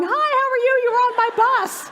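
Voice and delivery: high, loud, bubbly hello; cheery greeting. Retold or imitated. Bus passengers greeting each other in line, delighted. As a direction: sing out a delighted hello to a new friend.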